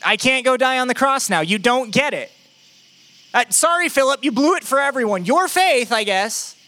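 mock disappointment, climbing in pitch; hammed-up blaming of a follower; exasperated Jesus. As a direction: start groaning, build to high-pitched exasperation. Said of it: "Jesus doesn't look back at him and goes".

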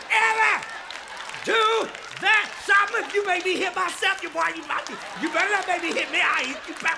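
high, very loud shouts chopped by belt blows; worked-up father's hammed-up bellow; dad talking while whipping. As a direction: yell each word with a swing. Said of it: "hit you with the belt while he was talking to you".

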